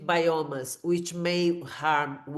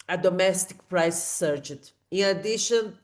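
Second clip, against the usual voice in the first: ordinary talk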